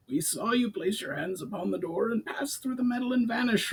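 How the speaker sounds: high goblin voice; a wary goblin reporting. A narrator's reading. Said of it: "replied one of the Uxra".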